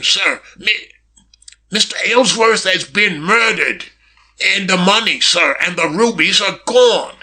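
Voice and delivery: high, halting, frightened servant voice; quavering footman. A narrator's reading. Footman breaks news of murder to Mister Kane, quavering. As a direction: break off, start over, afraid to be overheard. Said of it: "quavering note".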